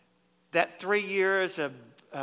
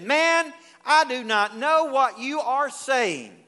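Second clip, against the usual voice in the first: louder, higher, slow angry denial; 6 semitones higher, 5 dB louder, slower than usual. Retold or imitated. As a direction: Peter snaps back at his accuser, loud and slow. Peter's final denial.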